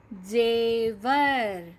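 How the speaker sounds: जेवर drawn out, je-var, every sound clear; slow model reading. Shown as a demonstration. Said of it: "धीरे धीरे सही उच्चारण".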